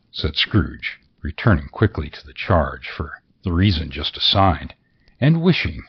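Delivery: plain reading voice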